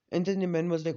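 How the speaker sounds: ordinary delivery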